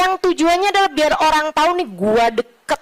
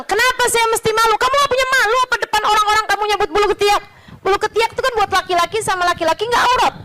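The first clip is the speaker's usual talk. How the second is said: rapid heated argument; 8 semitones higher, faster than usual. Retold or imitated.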